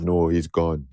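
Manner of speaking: friend's bass voice. Retold or imitated. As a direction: drop into his quiet bass. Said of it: "that bass in the voice".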